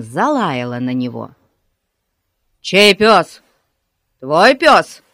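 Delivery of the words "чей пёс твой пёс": loud, stern police questioning; demanding. A narrator's reading. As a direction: fire the questions out loudly. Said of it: "требовательно спросил полицейский". (громко и требовательно)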